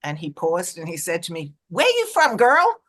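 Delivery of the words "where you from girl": higher, louder retold question; lively mock of a big stranger asking; imitating the man. Stranger at door questions her.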